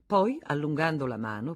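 usual speaking voice

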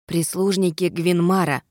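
ordinary delivery

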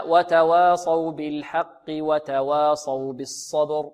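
slow وتواصوا بالحق وتواصوا بالصبر, linked through "haqqi" without stopping; joined recitation. Shown as a demonstration. Teacher showing the joined reading, measured continuous recitation. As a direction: recite straight from بالحق into وتواصوا in one breath. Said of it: "وإذا وصلنا".

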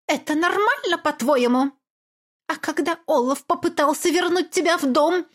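high, accusing tirade; berating mother. Acted. Mother scolding her daughter, high and bitter. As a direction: pile on the blame.

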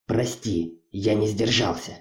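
soft, low-key admission; hushed and contrite; subdued apology. Acted. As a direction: apologise quietly, voice dropped. Acted apology after a scolding.

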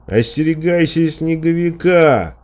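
high, sustained calling voice; repeated shouted warning. A narrator's reading. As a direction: cry the warning out insistently. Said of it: "повторил он".

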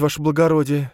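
high, flustered ваше благородие; nervous mother voice. Acted. Nervous mother greets noble.

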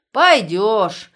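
loud forceful order; loud command. Acted. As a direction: force him with a shout. Guard forces prisoner. (громкий приказ)

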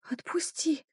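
barely audible breathy whisper. Acted. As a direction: plead in a faint whisper. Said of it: "едва различим".

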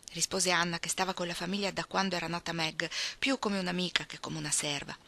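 ordinary delivery

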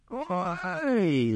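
high drawn-out yelp of pain; pained cry. A narrator's reading. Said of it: "закричал Булыжников". (высокий вскрик от боли)

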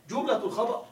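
ordinary talk, nothing marked

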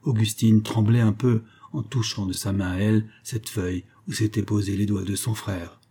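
ordinary delivery

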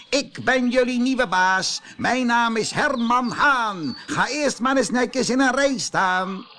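high, loud, pompous rooster; bossy rooster. Acted. New rooster boss orders the hens into line, pompous and loud. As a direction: strut and command the hens.

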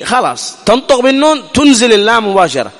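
normal talking voice

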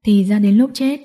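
usual speaking voice

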